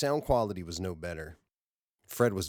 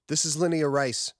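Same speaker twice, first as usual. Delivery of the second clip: a hint of a higher, louder strained voice; distressed woman; 9 semitones higher, 5 dB louder than usual. Woman on Titan opening her distress call, lightly strained. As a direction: start the message tense and urgent.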